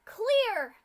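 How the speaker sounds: very loud, high, falling yell; screamed command. Acted. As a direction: bark it across the hall. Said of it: "Scootaloo screamed".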